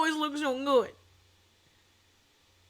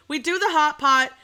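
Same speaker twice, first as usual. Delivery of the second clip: usual speaking voice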